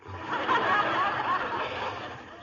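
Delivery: high mocking laugh. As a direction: laugh at your own quip. Laughs at own joke.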